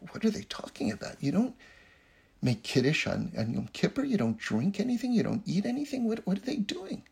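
mildly acted, puzzled questioning; bewildered disbelief. Leibler overhearing the two followers, bewildered disbelief. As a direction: question it in baffled disbelief, voice rising.

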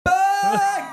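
very high, loud imitated scream; child's shriek. Child yelling at uncle on a video call, shriek. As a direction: shriek it out like an excited kid. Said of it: "Vad skriker han".

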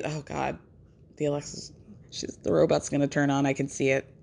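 whisper-quiet as if the robot might hear; hushed mock fear. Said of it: "fearing the Alexa robot".

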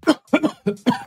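coughing fit. Real, not acted. Coughs before calling out.

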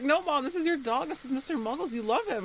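high-pitched, imploring imitation of a daughter; mock pleading. Claire pleads with her mom about the dog, high mock pleading.

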